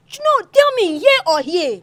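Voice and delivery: high, loud interrogation; exasperated shouted question; insistent demand. Acted. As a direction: demand an answer at the top of the voice. Demands answer to repeated question.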